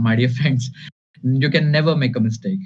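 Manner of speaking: ordinary talk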